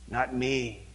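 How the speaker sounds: low, offhand brush-off; mock dismissive. Churchgoer waving off the call, low and offhand. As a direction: shrug it off lazily.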